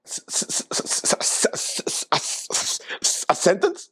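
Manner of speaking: stuck hissing on the first s; stammering moderator. Acted. Mock moderator asked to use it in a sentence, stammering. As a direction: stutter the s, then finish as a question.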